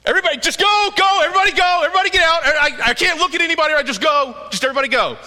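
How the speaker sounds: high, loud frantic order to clear the room; shouting everyone out. Acted. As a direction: shout them all out, voice near breaking. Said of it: "So he says".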